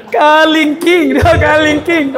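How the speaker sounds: Kalingking drawn slowly and high. Shown as a demonstration. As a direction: stretch out "Kalingking". (dahan-dahang bigkas ng "Kalingking")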